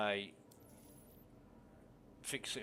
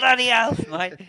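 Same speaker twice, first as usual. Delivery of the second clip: much higher, loud broad Aussie shout; aussie tradesman; 11 semitones higher, 12 dB louder than usual. Pretend. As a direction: crank the Aussie tradie up a notch. Repeating catchphrase even bigger.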